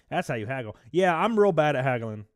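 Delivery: ordinary talk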